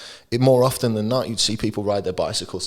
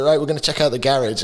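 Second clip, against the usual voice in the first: put-on English accent; 6 semitones higher, 4 dB louder, faster than usual. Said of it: "I'll always go back to garage".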